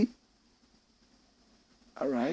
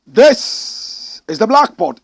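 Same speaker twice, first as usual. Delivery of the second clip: show announcer; 6 semitones higher, 10 dB louder, much slower than usual. Acted.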